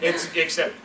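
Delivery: ordinary talk, nothing marked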